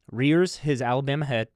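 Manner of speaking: ordinary talk, nothing marked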